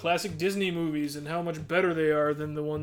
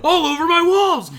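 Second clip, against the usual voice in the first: high, loud mock panic yell; Bob Saget yelling; 12 semitones higher, 8 dB louder, much slower than usual. Retelling Bob Saget's movie scene, a high mock shriek. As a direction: shriek it like a hammed-up comic freakout. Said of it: "He's like".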